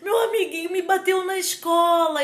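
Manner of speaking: put-on child tells Tia Tati a friend hit him, crying. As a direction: play a little kid sobbing out a complaint to his aunt. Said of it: "a criancinha veio chorando".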